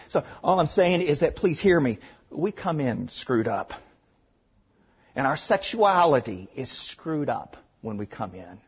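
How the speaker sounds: ordinary talk, nothing marked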